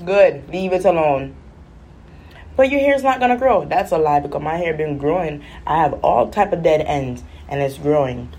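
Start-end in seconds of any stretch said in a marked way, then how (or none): 2.6-3.6 higher warning stylist; pushy stylist. Retold or imitated. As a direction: scold the client. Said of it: "every time I go to the salon".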